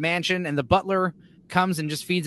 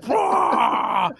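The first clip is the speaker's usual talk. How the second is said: loud, shouted monster roar; mock beast roar, higher and full-throated; werewolf roar; 5 semitones higher, 4 dB louder than usual. Werewolf bursting through the front door, imitated roar. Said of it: "it would just burst in through the front door".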